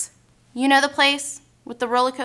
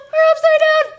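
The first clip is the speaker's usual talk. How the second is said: very high, loud alarm; acted panic; 13 semitones higher, 5 dB louder than usual. Rider in the loop-de-loop, panicked high cry. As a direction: cry out as if flipping over.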